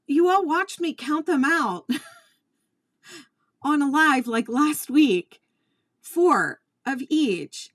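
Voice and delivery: high and loud, laughing through disbelief; exasperated half laugh. Real, not acted. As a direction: rant to viewers in disbelief, slowing on the count.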